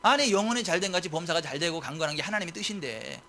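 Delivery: ordinary delivery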